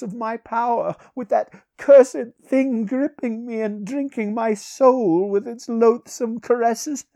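high, loud, theatrical sorcerer's tale; embittered sorcerer. Acted. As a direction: recount the torment in a high, intense voice.

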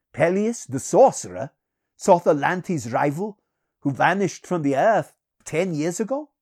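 astonished. A narrator's reading. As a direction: ask in disbelief, voice climbing. King astonished at the stranger's name.

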